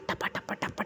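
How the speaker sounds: whispered soft patting of dough; imitated slapping sound. Narrator imitating roti sounds from the kitchen, whispered. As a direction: mimic the roti slaps under the breath. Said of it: "روٹی کی آواز تھی".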